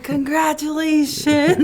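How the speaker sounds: high, loud, drawn-out mock congratulations; older man congratulating. Waiter cheering all through the video, hammed-up congratulations. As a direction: mimic an overeager well-wisher, stretching the word. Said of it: "He was an older man".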